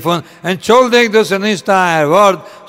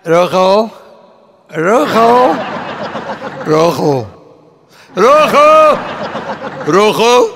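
shouting; 3 semitones higher, slower than usual. Retold or imitated. Patient in the asylum calling for Rochel, shouting. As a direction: cry out the name over and over. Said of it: "und er schreit".

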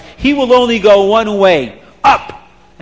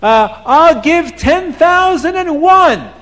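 higher, slow hopeful bid; eager father bidding; 10 semitones higher, slower than usual. Acted. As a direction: offer it with dreamy eagerness. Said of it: "the Tati says".

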